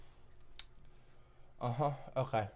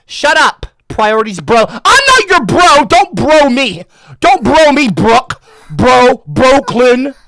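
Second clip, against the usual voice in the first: high, loud exasperated yelling; fed-up streamer shouting at viewers; annoyed yelling; 8 semitones higher, 13 dB louder, slower than usual. Real, not acted. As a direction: shout down the chat. Yelling at chatters over names.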